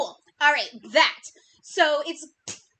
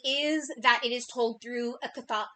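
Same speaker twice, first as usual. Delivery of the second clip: ordinary delivery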